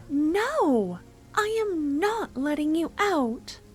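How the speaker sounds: normal talking voice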